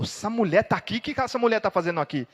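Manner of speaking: high, loud astonished question; shocked disbelief. Acted. Dreamer shocked to see the saintly woman in hell. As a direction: exclaim in horror at finding her. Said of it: "Ela falou".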